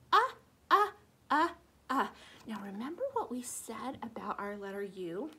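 normal talking voice

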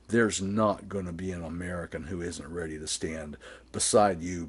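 normal talking voice

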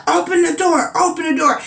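high, urgent mock command; imitating a police yell. Police officer outside her door, high urgent mock shout. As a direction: call through the door like a cop. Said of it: "policeman is steady yelling".

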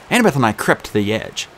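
usual speaking voice

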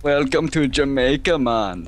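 slow, loud, hammed-up Jamaican accent; over-the-top Jamaican welcome; mock Jamaican. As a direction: greet them like a cartoon Jamaican. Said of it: "be Jamaican".

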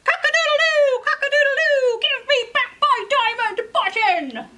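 high, loud rooster crow and shout; crowing rooster demand. Acted. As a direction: crow it out, then demand the button back. Rooster crows again.

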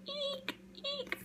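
very high, soft squeak; squeaking mouse. Acted. Little mouse squeaking at the cats, tiny squeak. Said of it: "and he's saying".

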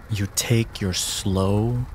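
ordinary talk, nothing marked

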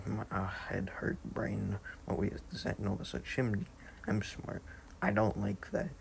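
normal talking voice

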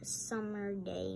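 plain reading voice

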